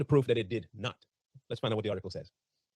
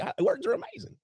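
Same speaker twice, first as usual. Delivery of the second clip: Jesse's voice. Retold or imitated. Host quoting Jesse to fans, high mock gushing voice. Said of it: "In the words of Jesse".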